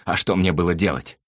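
plaintive, crying complaint; tearful voice. A narrator's reading. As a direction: whine a self-excuse as if crying. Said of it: "плачущим голосом заговорил Слава".

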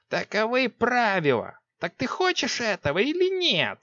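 high urgent witch voice; urgent sorceress. A narrator's reading. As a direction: press her like an impatient sorceress. Six presses.

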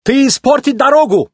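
very high, agitated Italian character voice; scolding little Italian. A narrator's reading. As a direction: scold like a small indignant Italian. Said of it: "ругался маленький итальянец по имени Луиджи".

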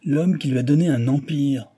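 a touch of trembling anger; trembling with rage. A narrator's reading. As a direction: answer back in contained fury. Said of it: "d'une voix tremblante de rage".

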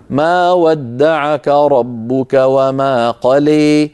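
slow Quranic recitation, قلى with tilted alif; recited with imala. Shown as a demonstration. Teacher recites verse for class, slow chant with imala. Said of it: "عندما نقرأ لحمزة والكسائي نقول".

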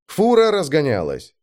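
ordinary delivery, nothing marked

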